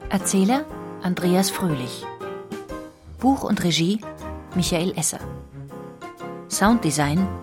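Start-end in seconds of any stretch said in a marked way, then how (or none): none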